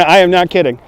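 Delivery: ordinary talk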